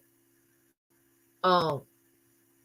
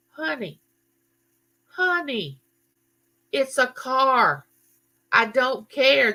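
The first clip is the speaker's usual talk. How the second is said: soothing mom; 6 semitones higher, slower than usual. Retold or imitated. Mother calming crying daughter.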